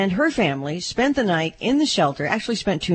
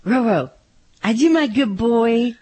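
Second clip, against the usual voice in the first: louder, rising higher coo to her dog; baby talk; 9 semitones higher, 5 dB louder than usual. Shown as a demonstration. Co-host calling her dog Roro in baby talk, rising higher. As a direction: sweet-talk the dog by name. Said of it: "that's pretty good".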